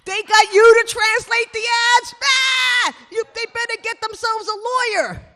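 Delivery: family shrieking over the ad translation; mocking family. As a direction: howl with laughter at her. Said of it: "they were like".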